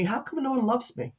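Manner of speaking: put-on high, soft child's question; retold forlorn question. Little boy to caseworker after mom missed visit, soft forlorn question. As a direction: ask small and quiet, like a hurt boy. Retelling neglected boy's sad question.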